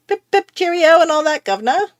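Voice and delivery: loud, high, hammed-up British; fake British accent. Shown as a demonstration. Joker showing off her bad habit, fake British accent. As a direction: put on a jolly, over-the-top British accent.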